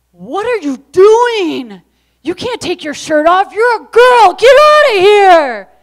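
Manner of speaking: loud, high, angry yell; brother yelling. Retold or imitated. Big brother yells at his little sister, loud and high. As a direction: shout him off the field. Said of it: "and he yells".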